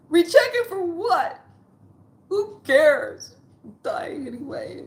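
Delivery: high, breaking, retold crying. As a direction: wail it out in hopeless tears. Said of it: "then I went to crying".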